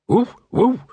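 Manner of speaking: high, loud "woof woof" like a small dog; mock dog bark. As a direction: bark it like a scruffy mutt. A dog barks behind them.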